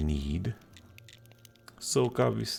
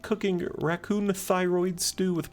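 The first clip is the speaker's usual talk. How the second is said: high, affected lady voice; helpless woman, now keen; 11 semitones higher than usual. Acted. Woman tells keeper of beloved recipe, high affected delight. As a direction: relish it in a high-pitched lady voice.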